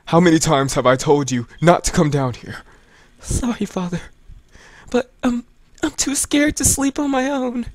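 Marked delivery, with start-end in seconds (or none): 3.2-7.7 put-on high, timid child's voice; scared little girl. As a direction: sound like a frightened child at night. Voicing Aya, the scared girl.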